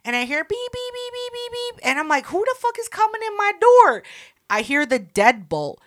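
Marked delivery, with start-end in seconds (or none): 0.4-1.8 high, soft beep-beep keypad imitation; chirpy electronic beeps; imitated keypad beeps. Imitates door keypad beeping. 2.3-4.0 high, startled who's-at-my-door; retold panic, pitched way up; alarmed.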